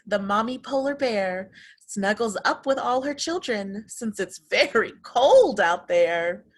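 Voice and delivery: plain reading voice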